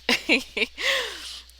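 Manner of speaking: high, bright burst of laughter. Real, not acted. Vlogger laughing after imitating her friend's protest, amused. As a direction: laugh at your own joke.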